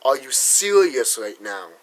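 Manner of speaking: soft, slow disbelief; incredulous. Retold or imitated. Vlogger retelling his disbelief at the school, soft slow incredulity. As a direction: drop the voice in flat disbelief. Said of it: "I'm like".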